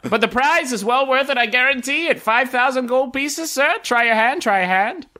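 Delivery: high-pitched sales patter; enticing game vendor. Acted. Vendor urging a player to try, high coaxing patter. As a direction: coax them in like a fairground hawker. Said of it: "one particularly enticing vendor who's".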